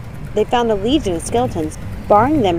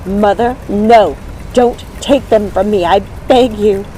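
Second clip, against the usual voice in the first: loud desperate begging; pleading cry; 2 semitones higher, 6 dB louder, slower than usual. Acted. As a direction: beg the mother to spare them. Begging mother to spare sisters.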